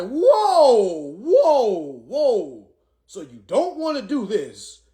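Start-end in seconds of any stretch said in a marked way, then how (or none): 0.0-2.7 high, loud drawn-out whoas; hammed-up protest, fading; mock alarmed. Himself cutting her off, high loud whoa. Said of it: "I'm like".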